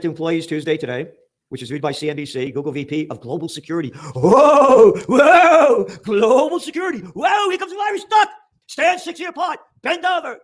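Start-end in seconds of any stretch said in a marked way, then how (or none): 4.1-7.0 loud high mocking whoa; hammed-up amazement at a title; mock awe. Real, not acted. 7.0-10.4 high shouted mock panic; hammed-up alarm drill. Mocking covid safety drill.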